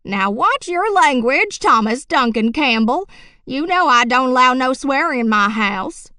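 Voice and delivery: scolding. Acted. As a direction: scold him sharply for cursing. Grandma scolds son for swearing.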